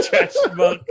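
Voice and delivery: loud name through laughter; laughing loudly. Real, not acted. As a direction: shout the name while laughing.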